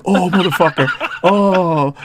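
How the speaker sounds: hammed-up outraged "oh"; mock triggered reaction. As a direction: imitate someone getting worked up. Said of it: "he got triggered as shit".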